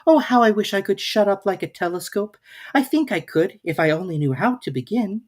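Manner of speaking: put-on a young girl sighing a wish; Alice's voice. A narrator's reading. Alice's thoughts in her voice.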